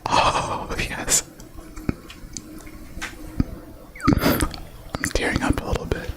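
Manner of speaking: breathy close whisper; ASMR whisper. Acted. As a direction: whisper right into the mic. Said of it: "in A S M R voices".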